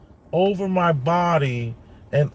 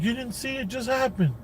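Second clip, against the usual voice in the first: put-on high voice of an excited young son; imitating his son, high and eager; son's voice; 10 semitones higher, 5 dB quieter than usual. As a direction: pitch up to play the boy breathlessly telling dad. Father voicing young son's words.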